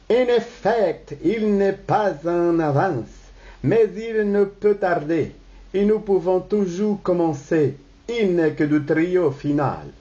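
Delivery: put-on high, lilting stage Italian accent. Babylas covers for the missing singer, Italian accent. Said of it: "avec l'accent italien".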